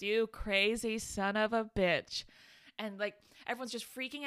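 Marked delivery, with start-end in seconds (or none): 0.0-2.2 slow, drawn-out movie quote; Jeff Goldblum. Retold or imitated. Podcaster quoting an incredible line, slow drawn-out delivery. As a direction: stretch each word in imitation. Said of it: "Jeff Goldblum, he, he goes".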